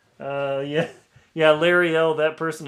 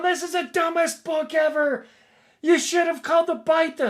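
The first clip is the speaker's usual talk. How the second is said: much higher, louder mocking reviewer voice; mock reviewer rant; 8 dB louder than usual. Author mocking an angry Amazon reviewer, high shrill-free mock outrage. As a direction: squeal it like an outraged reader.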